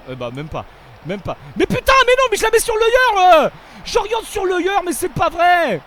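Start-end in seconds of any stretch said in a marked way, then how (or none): 1.5-5.8 very high, loud raging yell; furious shout. Real, not acted. Gamer raging at his own misdirected pass, furious shout. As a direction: explode at your own bad pass.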